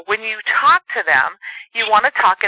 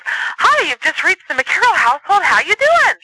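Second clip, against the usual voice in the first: chirpy phone greeting; 8 semitones higher than usual. Shown as a demonstration. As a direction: answer the phone bursting with cheer. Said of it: "talks very monotone and slow".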